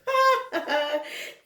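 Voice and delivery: short real chuckle; light genuine laugh; amused laugh. Real, not acted. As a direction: laugh at the joke. Laughs at the funny ending.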